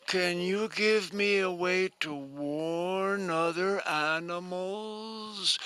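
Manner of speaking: high, slow and timid, a little pleading; high slow shy snake voice; gentle snake voice. Acted. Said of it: "And the snake said".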